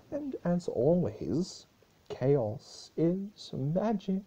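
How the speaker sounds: slow, high and loud signature phrase; grand, drawn-out channel motto; catchphrase sign-off. Acted. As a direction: draw it out like a trademark slogan. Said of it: "channel sign-off catchphrase".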